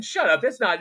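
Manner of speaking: high-pitched imitated telling-off; mock scolding. Retold preacher silencing his congregation.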